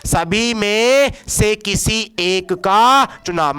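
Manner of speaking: very slow, drawn-out teaching delivery; slow emphatic repetition. Shown as a demonstration. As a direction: stretch each phrase out for the class. Teacher repeats definition for emphasis. (धीरे-धीरे, खींचकर ज़ोर देते हुए)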